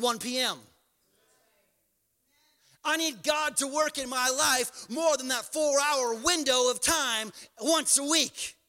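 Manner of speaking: loud, high, driving sermon climax; preaching at full force. Acted. Pastor rallies the congregation, voice rising to a loud passionate shout.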